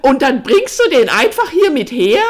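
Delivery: high, very loud accusing question; shrill outrage. A narrator's reading. Sister accusing her brother over the visitor, shrill outrage. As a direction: shriek the accusation at full volume. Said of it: "ihre Stimme wurde schrill".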